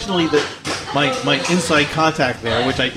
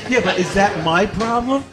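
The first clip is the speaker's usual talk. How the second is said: higher, indignant retelling; exasperated protest; 6 semitones higher than usual. Retold or imitated. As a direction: push back, incredulous and rising. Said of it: "I said".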